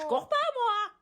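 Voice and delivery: high loud indignant voice; put-on voice. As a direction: squeal it out in a put-on voice. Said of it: "pourquoi t'as pris cette voix".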